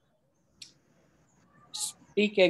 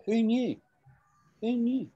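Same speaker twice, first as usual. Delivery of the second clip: much higher-pitched, falling at the end; Scottish catchphrase; 10 semitones higher than usual. Shown as a demonstration. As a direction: pitch it up high like a quoted catchphrase. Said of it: "This is a Scottish thing. We would say".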